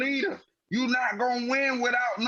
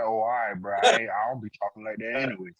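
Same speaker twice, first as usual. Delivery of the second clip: lower, fast, lightly voiced mumbled reply; imitating Eric Bledsoe; 10 semitones lower, much faster than usual. Picturing the new guard answering Kawhi, in a low quick mumble. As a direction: toss it off low and quick like a shrug. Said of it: "Eric Bledsoe be like".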